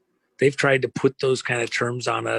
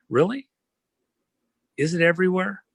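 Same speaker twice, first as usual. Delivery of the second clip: incredulous; 8 semitones higher, faster than usual. Real, not acted. Sarcastic rebuttal of the naysayers.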